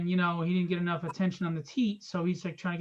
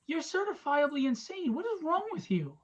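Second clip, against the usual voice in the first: imitating a wife's higher-pitched scolding; mock exasperation, pitch climbing into the question; exasperated wife; 8 semitones higher than usual. Retold wife scolding him.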